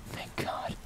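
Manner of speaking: hushed, voiceless sigh of thanks; relieved whisper. A narrator's reading. Hunter seeing dad's truck at last, relieved whisper. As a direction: whisper it under your breath with relief.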